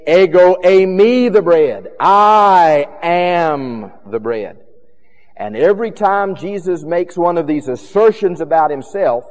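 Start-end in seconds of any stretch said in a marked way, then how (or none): 0.1-4.6 "Ego eimi" and "I am" loud and drawn out; stress on I AM. Shown as a demonstration. Preacher demonstrating Greek emphasis, loud slow stress on "I am". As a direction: boom out "I am" slowly, ease off on "the bread". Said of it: "really wanted to emphasize the first part".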